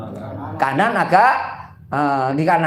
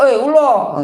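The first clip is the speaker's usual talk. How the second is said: mock shout; 7 semitones higher, 4 dB louder than usual. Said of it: "yang teriak-teriak".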